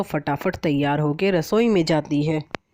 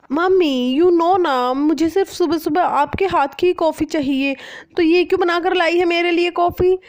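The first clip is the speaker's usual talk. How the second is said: much higher, louder pampered complaint; spoilt whiny daughter; 13 semitones higher, 5 dB louder than usual. Acted. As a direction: sulk like a spoilt rich girl. Spoilt daughter complains about coffee.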